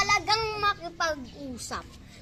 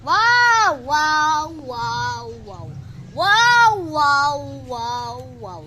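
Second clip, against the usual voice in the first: mocking; 2 semitones lower, 3 dB louder, much slower than usual. Acted quarrel, mocking repeated wows.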